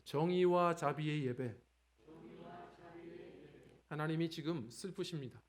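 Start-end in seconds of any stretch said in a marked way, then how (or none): 0.0-1.6 a slow, louder line for the church to echo; slow slogan to repeat. Shown as a demonstration. Pastor gives the congregation a slow, louder line to repeat. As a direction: read out the day's theme slowly. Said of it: "우리 함께 따라하겠습니다".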